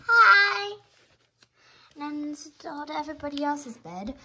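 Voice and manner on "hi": high, loud pretend-kid greeting; put-on squeaky little voice; little sibling voice. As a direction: squeal hello like a small child. Voicing small sibling greeting.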